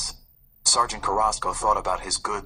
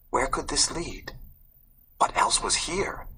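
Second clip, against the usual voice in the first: high, breathy whispered question; a high hushed question full of wonder; excited whisper; 5 semitones higher than usual. A narrator's reading.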